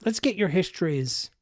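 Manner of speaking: ordinary talk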